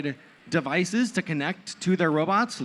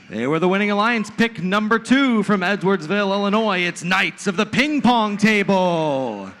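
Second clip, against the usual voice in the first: fighting game announcer; 4 semitones higher, 6 dB louder than usual. Acted. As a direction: stretch the team name like a fight intro. Hype team introductions.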